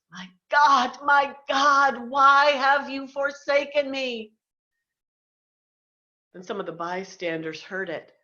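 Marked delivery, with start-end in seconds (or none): 0.1-4.3 loud and higher, pleading in despair; loud forsaken plea, higher in pitch; anguished cry. Acted. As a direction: cry out to heaven, feeling abandoned. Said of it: "Jesus cried with a loud voice".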